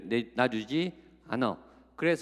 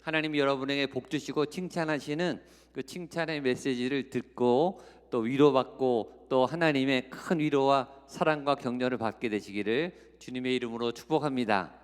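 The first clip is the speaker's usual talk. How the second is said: ordinary talk, nothing marked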